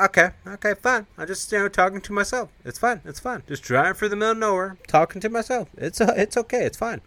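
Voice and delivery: high-pitched mock resignation; put-out self-talk. Voices driver talking to himself.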